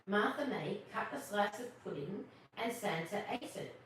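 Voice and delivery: ordinary delivery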